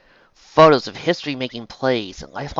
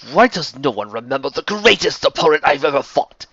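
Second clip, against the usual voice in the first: loud, raised Scots grumbling; indignant complaint; 5 semitones higher, 7 dB louder than usual. Acted. Forgotten villain complaining about his greatest opponent, indignant complaint. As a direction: protest loudly with wounded pride.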